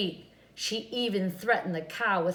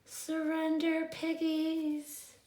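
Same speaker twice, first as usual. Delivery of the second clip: witch call; 7 semitones higher, 3 dB quieter, slower than usual. A narrator's reading. Witch calls to the pigs.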